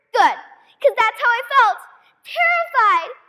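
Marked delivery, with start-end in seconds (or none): 2.2-3.2 terrified. Acted. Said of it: "Is terrified too dramatic a word?".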